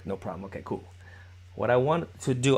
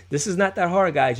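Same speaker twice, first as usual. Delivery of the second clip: raised, higher and louder; loud encouragement; 5 semitones higher, 6 dB louder than usual. Real, not acted. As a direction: pump them up. Coach encouraging class.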